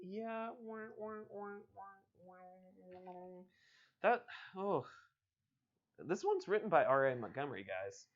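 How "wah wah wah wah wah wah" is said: mock sad trombone, higher, fading softer. Mocking the boring ending with a sad trombone. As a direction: hum a deflated wah-wah.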